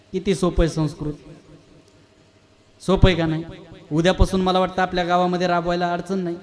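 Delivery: ordinary talk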